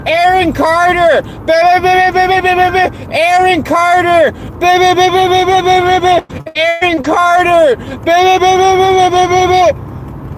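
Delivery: high-pitched mocking name-chant with babble; mocking chat regular. Imitating a woman always in this voice chat, mocking high babble. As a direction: babble on one high, silly note. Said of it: "always in this voice chat going".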